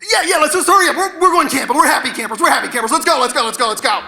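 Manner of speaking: high-pitched rush that eases down; hurried dad. Acted. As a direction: brush the kid off and hurry everyone along. Father hurries kids along, brushing off questions.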